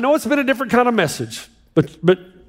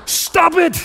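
higher-pitched, emphatic outburst; exasperated command; 5 semitones higher than usual. Shown as a demonstration. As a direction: drive the blunt order home. Repeats blunt punchline after laughter.

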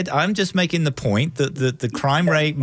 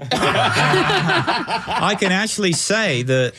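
drawn-out laughing line; 7 semitones higher, much slower than usual. Real, not acted. Guest laughing back at the host's joke, drawn out. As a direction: stretch it slowly through laughter.

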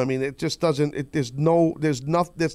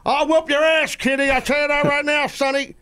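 put-on much higher cranky old man threatening; tough old grandpa; 11 semitones higher than usual. Old man in grandpa glasses threatening kids, cranky high voice. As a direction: play a feisty old geezer picking a fight. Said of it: "I'm acting really tough with my grandpa glasses on".

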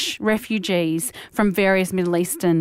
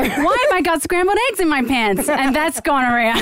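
put-on American accent; 7 semitones higher than usual. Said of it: "Can you put the accent on?".

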